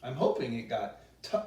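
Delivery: plain reading voice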